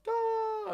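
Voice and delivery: high, loud drawn-out "da"; exaggerated falsetto howl; comic cry of exasperation; mock wail. Retold exasperated reply on phone.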